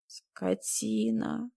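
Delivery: very slow, drawn-out whimper. A narrator's reading. Woman cursing her husband who left her, whimpering. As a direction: whine it out, stretching every syllable. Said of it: "проскулила я".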